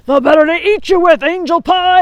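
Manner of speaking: loud triumphant wolf roar. Acted. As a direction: bellow it as the wolf pounces. Wolf pounces.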